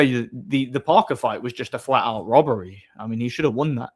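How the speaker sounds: ordinary talk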